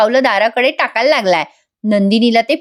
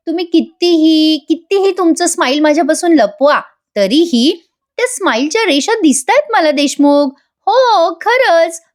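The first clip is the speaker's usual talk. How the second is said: playful teasing; 7 semitones higher than usual. Acted. Kavya teases Parth about smile.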